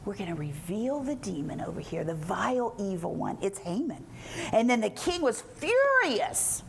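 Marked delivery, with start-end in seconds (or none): 0.0-4.0 low, hushed melodramatic reveal; mock-ominous soap reveal; soap opera drama. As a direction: unmask the villain in a soft, lowered voice. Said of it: "you know, a soap opera".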